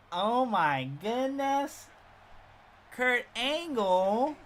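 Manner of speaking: high, drawn-out southern lady, appalled; older southern woman. Retold or imitated. Older teacher comes out of the bathroom, appalled southern accent. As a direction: wail it slowly in a hammed-up southern accent. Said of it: "a southern accent".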